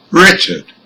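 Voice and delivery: "Richard" stressed on RICH, falling away after; first-syllable stress. Shown as a demonstration. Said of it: "the first syllable".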